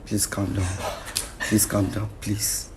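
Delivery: low, hushed calming voice; soothing plea. Real, not acted. Mediator calming an angry husband, soothing plea. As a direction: gently talk him down, softly.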